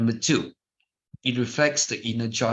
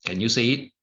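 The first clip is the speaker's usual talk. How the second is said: usual speaking voice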